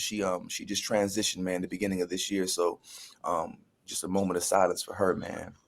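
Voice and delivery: low, soft, subdued remembrance; somber respect. Real, not acted. Speaker honours a department chair who died, somber respect. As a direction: drop the voice in quiet respect.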